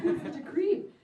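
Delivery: normal talking voice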